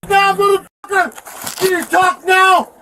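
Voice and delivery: high, loud enraged shout; real slow, heated shouting; angry yelling. Real, not acted. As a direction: yell furiously at the officer, drawing words out. Detainee yelling at police officer.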